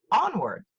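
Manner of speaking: "Onward" as ON-ward, high on ON; "Onward" with a clear accent on ON; first-syllable stress. Shown as a demonstration. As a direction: punch the start of "Onward". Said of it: "first syllable is accented".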